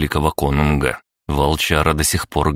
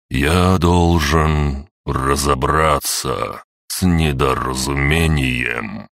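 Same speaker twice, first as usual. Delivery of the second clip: enveloping slow voice; much slower than usual. Acted. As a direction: wrap the words around him slowly. Tree elder speaks again.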